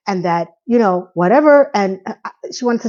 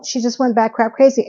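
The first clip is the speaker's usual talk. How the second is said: usual speaking voice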